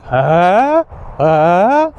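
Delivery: "Huh" rising like a startled question; surprised huh. Shown as a demonstration. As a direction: sound shocked, pitch sliding up. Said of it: "you're kind of surprised".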